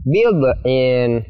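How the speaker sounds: "bid'in" bounced, like bi-do-in; wrongly bounced d. Shown as a demonstration. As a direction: add a short vowel after the d of "bid'in".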